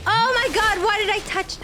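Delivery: high, upset cry; distressed whine. Real, not acted. Gamer touching the wrong thing, distressed whine. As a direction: wail at your own blunder.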